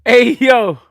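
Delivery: loud, high shout; real high-pitched, booming exclamation; amused outburst. Real, not acted. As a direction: holler it in amused disbelief. Exclaims at a joke in chat.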